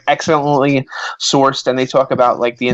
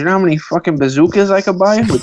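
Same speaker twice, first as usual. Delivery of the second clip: put-on thrilled Irish kid; 5 semitones higher than usual. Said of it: "Irish kid would just be like".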